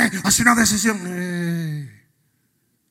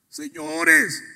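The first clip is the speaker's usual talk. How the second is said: much higher, loud proclaiming sermon; ferviente predicación; 10 semitones higher, 8 dB louder than usual. Acted. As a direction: proclaim Christ's titles at full force, much higher. Preaching at full force. (proclamación a todo pulmón, mucho más aguda)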